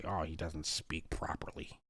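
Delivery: lightly voiced uppity complaint about speech; mocking snobs. Host mimics snobs who sneer at his speech, mild mockery. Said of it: "even uppity blacks say".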